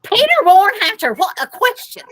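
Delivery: high, loud scolding cry of his full name; grandma shouting. Acted. Grandma answering Peter's silly question, loud and high. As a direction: exclaim it, amazed he'd ask. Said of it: "Grandma shouted".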